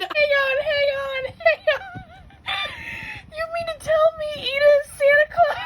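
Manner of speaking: high, loud squealing disbelief; excited shout. Real, not acted. Fan thrilled Ida dresses as Santa, squealing shout. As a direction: squeal it, delighted and incredulous.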